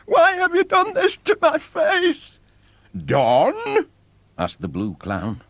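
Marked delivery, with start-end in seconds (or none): 0.0-2.4 put-on very high, loud pleading boy's voice; high, loud desperate plea; frightened child. Boy pleads with clowns. 3.0-3.9 high, loud puzzled question; honestly baffled, rising; a clown surprised by the boy's question. Acted. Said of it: "real surprise in his voice".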